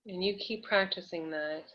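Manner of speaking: soft, calm guiding voice with long pauses; guided breathing exercise. Real, not acted. Coach guides nervous client to breathe and feel safe, soothing. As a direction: lead them gently through affirmation and breath, softly.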